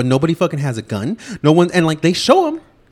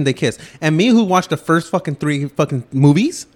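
normal talking voice

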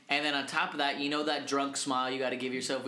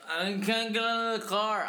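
higher, slurred drunk imitation; 6 semitones higher than usual. Drunk friend stuck in the car, slurring. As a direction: run the words together like a helpless drunk. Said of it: "You're that fucking drunk".

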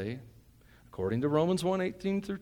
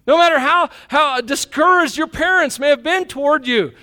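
higher and louder, fervent; excited preaching; 12 semitones higher, 9 dB louder than usual. Real, not acted. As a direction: pound each line home to the church. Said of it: "getting excited".

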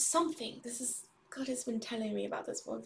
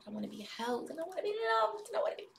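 yearning plea; 9 semitones higher than usual. Real, not acted.

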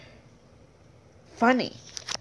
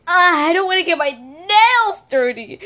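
sassy; 6 semitones higher than usual. Retold or imitated. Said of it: "a really sassy tone".

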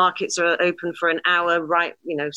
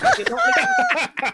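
laughing; much slower than usual. Real, not acted. Laughing at stubborn dad.

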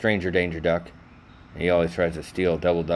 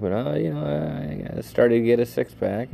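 normal talking voice

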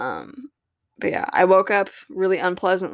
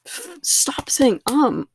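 whispered to herself; 7 semitones higher, much slower than usual. Real, not acted. Scolding herself for saying um.

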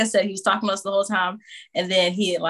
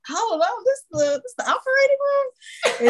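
high, squeaky astonished question; mother marvels at the music in the operating room, mock astonished; mock astonishment.

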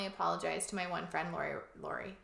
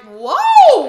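loud, startled whoa; mock shock; 9 semitones higher, 20 dB louder than usual. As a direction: jolt back at the shock of it. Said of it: "and you're like".

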